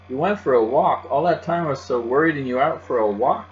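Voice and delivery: upset. Acted. Louie upset, worried.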